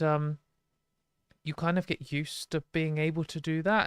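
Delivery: normal talking voice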